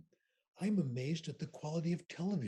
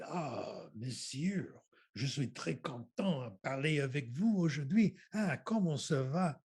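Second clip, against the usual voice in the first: hammed-up French accent, louder toward the end; exaggerated French accent; 5 dB louder than usual. Student mocking his French examiners, exaggerated French accent. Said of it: "he exaggerated a French accent".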